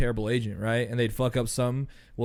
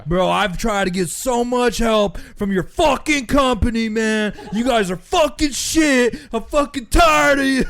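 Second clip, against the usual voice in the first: furious yelling, higher and louder, slow; angry shout; 10 semitones higher, 7 dB louder, slower than usual. Retold or imitated. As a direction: rant at the agent full force. Irate customer yelling at agent.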